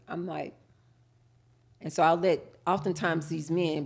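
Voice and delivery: ordinary talk, nothing marked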